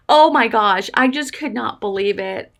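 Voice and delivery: usual speaking voice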